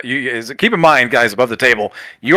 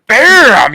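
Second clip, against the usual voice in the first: high, loud comic-book bam; loud impact "bam"; 11 semitones higher, 8 dB louder than usual. Acted. Game master narrating a headbutt, loud impact bam. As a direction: punch it out like a hit landing.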